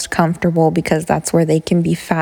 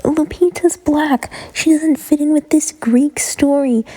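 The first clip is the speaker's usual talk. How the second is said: high, slow, snooty put-on voice; mocking critic voice; 8 semitones higher than usual. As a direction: sneer it like a scornful critic. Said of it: "people are like".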